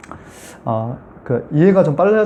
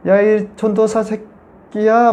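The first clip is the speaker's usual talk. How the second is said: slow, higher sneering insult from a possessed woman; demon cursing; 7 semitones higher, much slower than usual. Retold or imitated. Possessed woman cursing the young preacher, mild mock sneer. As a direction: drag out the curse mockingly. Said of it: "막 이렇게 막 욕을 하면서".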